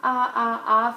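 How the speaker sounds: loud open ah sounds; saying ahh. Shown as a demonstration. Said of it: "I want you to say".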